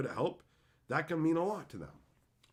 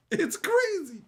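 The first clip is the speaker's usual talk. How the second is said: much higher, louder marvel; the louder, higher second take; amazed exclaim; 8 dB louder, slower than usual. Real, not acted. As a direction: shout it in disbelief.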